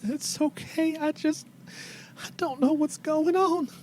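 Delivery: old woman holding back pretend tears; tearful old lady. Old mother whose boy left, answering an apology, high and tearful. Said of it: "she starts to cry".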